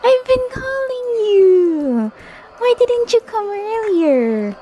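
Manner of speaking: put-on high, slow whiny baby voice; voicing the baby. Mom voicing her baby's complaint to her in a high voice. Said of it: "You're like".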